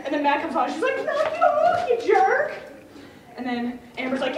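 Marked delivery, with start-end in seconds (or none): 1.1-2.5 very high, loud telling-off; exaggerated imitated indignation; mock scolding. Imitates woman scolding brother.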